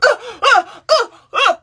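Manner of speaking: frozen with fear, only choked uh sounds come out; terrified stammer. Retold or imitated. Woken by a grab in the dark, a high frozen panicked stammer. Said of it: "Literally, that's all I could do".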